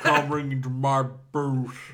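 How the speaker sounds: slow, low giant's voice; lightly voiced slow giant; Andre the Giant voice. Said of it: "my impromptu Andre the Giant impression".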